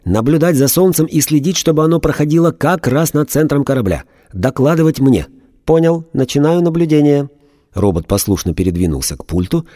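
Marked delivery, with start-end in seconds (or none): 0.0-5.3 angry shout. A narrator's reading. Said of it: "окончательно рассердился и закричал". 5.6-7.3 higher, even machine voice; a robot acknowledging an order; emotionless robot. A narrator's reading.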